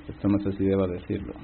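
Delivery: low, hushed second thought; hesitant aside. Real, not acted. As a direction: drop right down, as if unsure to go on. Hesitant aside before delicate story.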